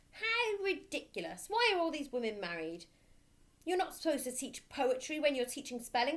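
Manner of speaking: scornful headmistress. Acted. Headmistress mocking the rhyme, loud and scornful. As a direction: sneer it at the teacher.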